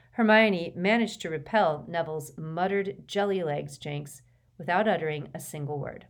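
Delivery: plain reading voice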